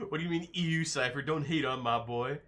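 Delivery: normal talking voice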